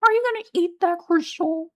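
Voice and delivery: very high, squeaky cartoon voice; Carl Wheezer. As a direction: bring the voice way up into a squeak. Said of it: "it's kinda squeaky".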